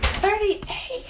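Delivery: high rising shocked echo; mock shocked repeat; shocked question. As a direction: repeat his age in disbelief. Shocked at his age.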